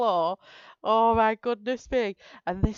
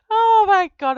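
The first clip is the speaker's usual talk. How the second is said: loud, high laughing fit; real helpless laughter; crying with laughter; 12 semitones higher, 8 dB louder than usual. Real, not acted. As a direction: laugh till you cry. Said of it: "I'm crying now".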